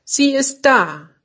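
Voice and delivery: höher und freudig ausgerufen; jubelnd. A narrator's reading. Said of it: "jubelte er". (jubelt vor Freude über die Hoffnung)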